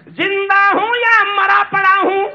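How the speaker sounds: high, loud poetic cry; ringing declamation; anguished verse. Acted. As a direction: cry out the question to the hall. Anguished question repeated.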